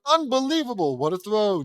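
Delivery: high, excited sportscaster cry; amazed announcer. Acted. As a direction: hail an incredible throw. Announcer hails the winning throw.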